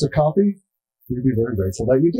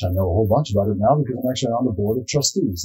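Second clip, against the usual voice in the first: ordinary delivery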